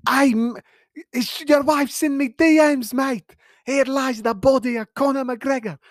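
high-pitched, badly faked Irish accent. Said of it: "I'm usually better at accents".

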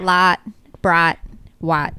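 low Southern vowels, ah for i; Southern list, each i flattened to ah; Southern accent. Shown as a demonstration. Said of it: "No podcast voice say those three".

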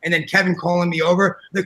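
ordinary talk, nothing marked

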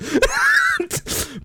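high shrieking burst of laughter. Real, not acted.

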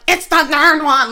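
high, loud snappy reply; mimicking her same voice. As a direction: snap it back in the boss's own tone. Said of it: "I did her same voice".

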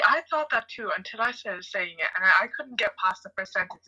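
ordinary delivery

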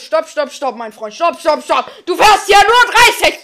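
shouting stop. Pretend. Stopping a fast driver.

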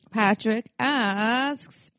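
playful elongated lead-in; drawn-out announcement. Pretend. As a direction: draw each word right out. Stretched introduction of a listener question.

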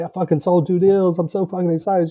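imitating his own over-the-top excitement; excited bragging. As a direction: gush with pride like a kid showing off. Retelling his own bragging to wife.

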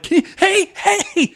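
put-on very high, childlike pleading; childish mock caller. Mocking childish callers, very high voice. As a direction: sound like a little kid on a call. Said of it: "how childish it is to go like".

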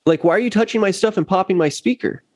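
retold indignant complaint; peeved. Bassist confronting the sound guy over his gear, peeved. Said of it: "I was peeved".

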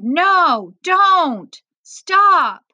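high, loud, desperate pleading; begging cry. A narrator's reading. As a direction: beg them at a shout to stop. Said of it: "Mohammed begged".

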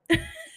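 sudden high-pitched laugh; high squeal laugh. Real, not acted. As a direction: let out a squeaky giggle.

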